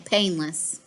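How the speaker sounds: plain reading voice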